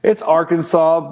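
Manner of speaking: Arkansas said slowly as AR-kən-saw; standard pronunciation of Arkansas. Shown as a demonstration. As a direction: firmly say Arkansas ending in "saw". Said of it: "Which is it?".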